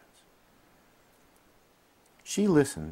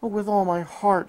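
much higher, louder heartfelt cry; 13 semitones higher, 7 dB louder than usual. A narrator's reading. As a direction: pour out your love. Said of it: "she cried".

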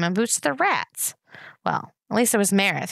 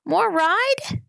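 high, far-up little voice begging; pleading toddler; 13 semitones higher, 3 dB louder than usual. A narrator's reading. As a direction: beg sweetly for one more ride. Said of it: "pleaded Boots".

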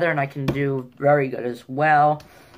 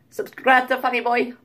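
much higher imitation of a Spanish accent; high-pitched put-on Spanish accent; mock Spanish accent; 8 semitones higher, 4 dB louder than usual. Shown as a demonstration. Vlogger running through accents for his plug, mock Spanish.

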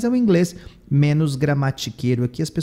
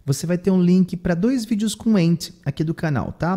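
ordinary talk, nothing marked

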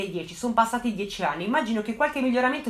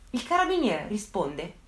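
ordinary delivery

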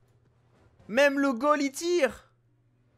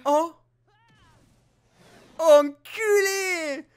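long, drawn-out loud cry of dismay; anguished curse; 8 semitones higher, 8 dB louder, much slower than usual. Real, not acted. Gamer reacting to a goal against him, drawn-out loud curse. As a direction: stretch the swear out in despair. Opponent scores, player cries out.

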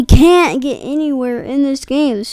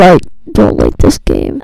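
deep silly voice. Acted. Scared gamer whining.

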